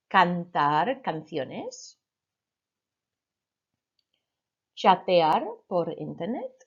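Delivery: clear, spaced Spanish model phrases; model Spanish for repeating. Shown as a demonstration. Teacher modelling phrases for the class to repeat, clear and spaced. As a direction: say each phrase slowly for the class to echo. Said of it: "repeat after me".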